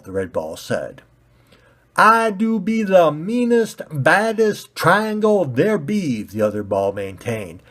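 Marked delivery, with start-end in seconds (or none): 2.0-6.2 high, loud bumpkin brag. Acted. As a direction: puff up and threaten. Pointy ball boasting.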